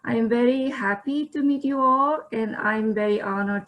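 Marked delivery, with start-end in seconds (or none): none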